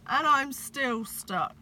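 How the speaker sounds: loud complaint. A narrator's reading. Santa stuck in the chimney, slow loud grumbling. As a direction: drag it out in frustration. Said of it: "yelled Santa".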